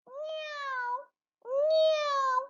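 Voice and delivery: very high mewing meow; teacher mewing for the children; cat meow imitation. Shown as a demonstration. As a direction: mew like a tiny kitten, way up high. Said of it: "what is the cat sound?".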